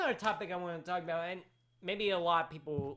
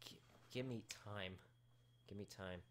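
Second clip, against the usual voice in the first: very soft, subdued reply; calming down; 4 semitones lower, 13 dB quieter than usual. Real, not acted. Host promising companion to calm down, very quiet. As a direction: drop right down in volume, asking for time. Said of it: "Will you calm down?".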